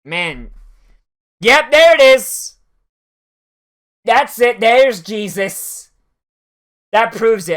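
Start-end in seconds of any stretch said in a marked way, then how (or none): 1.4-7.6 loud, high burst of triumph; excited shout. Real, not acted. Gamer spotting the Eye of Sauron, excited shout. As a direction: yell it like you just spotted the proof.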